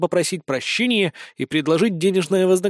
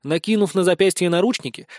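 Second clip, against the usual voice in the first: plain reading voice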